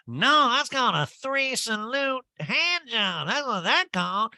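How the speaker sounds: very high, slow, swooping drawl; hammed-up know-it-all impersonation; Erica. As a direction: caricature her emphatic correction. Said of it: "Erica's like".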